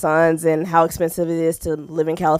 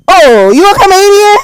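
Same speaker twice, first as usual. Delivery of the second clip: high, loud mock excited man; dropped put-on voice; 10 semitones higher, 12 dB louder than usual. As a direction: imitate him squealing the question, high and loud. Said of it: "I knew you was putting on a voice".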